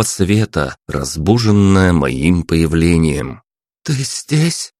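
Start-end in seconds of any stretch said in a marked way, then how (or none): 3.8-4.7 put-on higher, soft young woman's voice; a girl just woken, unsure; sleepy princess. A narrator's reading. Princess Anya wakes and sees Maxim, unsure.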